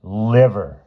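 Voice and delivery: loud, disgusted one-word reply; mild disgust, pushed out loud; loathing. A narrator's reading.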